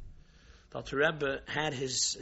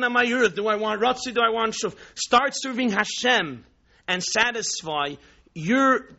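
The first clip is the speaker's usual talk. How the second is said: ordinary delivery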